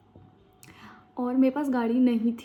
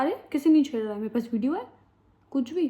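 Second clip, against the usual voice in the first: retold angry retort; 3 semitones higher, 3 dB louder, faster than usual. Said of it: "मैं भी चिल्लाने लगी".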